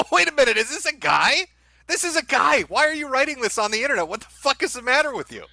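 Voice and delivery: real shock turning into a rant; high, fast appalled disbelief; incredulous outrage. Real, not acted. Realizes poster is a guy.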